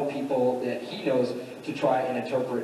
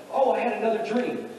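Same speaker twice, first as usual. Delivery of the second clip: higher-pitched, eager imitation of a dreamer; excited announcement; 9 semitones higher than usual. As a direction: act out bursting with news. Joseph eagerly announces another dream.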